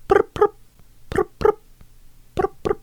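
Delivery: high squeaky cricket chirps; chirping cricket voice. Shown as a demonstration. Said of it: "That's my cricket impression".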